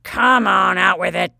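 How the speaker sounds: put-on loud, gruff old man's cry; an impatient old colonel shouting; testing cry. A narrator's reading. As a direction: prod the boy, loud and testing. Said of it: "cried Colonel Pinner testingly".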